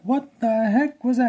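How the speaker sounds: high, loud startled exclamation; amused disbelief. Retold or imitated. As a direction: act out an incredulous, amused reaction. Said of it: "she's laughing up a storm".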